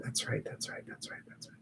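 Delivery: breathy whisper of encouragement; whispered affirmation. Real, not acted. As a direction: whisper it to the group. Murmured affirmation during meditation.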